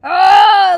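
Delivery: sharp angry yell of frustration; loud venting shriek; frustrated scream. Retold or imitated. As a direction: let out a short raging scream. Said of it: "'Cause I'm like".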